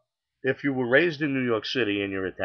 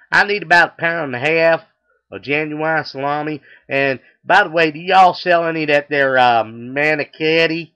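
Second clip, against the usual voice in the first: loud, raised Texan twang; southern accent; 4 semitones higher, 8 dB louder than usual. Acted. Customer orders from the deli clerk, loud southern accent. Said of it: "he's got a southern accent".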